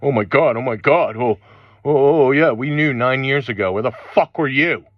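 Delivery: high, loud hammed-up panic of latecomers; mocking latecomers. Mocking people who only just woke up, loud and high. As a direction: squawk it out like a late convert in a frenzy. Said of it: "these people are starting to go".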